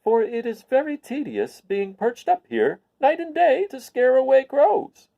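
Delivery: high slow tedious complaint; weary scarecrow. Acted. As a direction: drag it out like a bored straw man. Scarecrow voiced.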